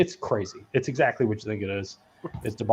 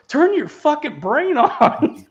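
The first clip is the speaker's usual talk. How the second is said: high, slow, louder line through laughter; mock exasperated yell; 5 dB louder, slower than usual. Quoting a guy scolding a dumb coworker, high, slow and laughing. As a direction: laugh out the angry line, dragging it.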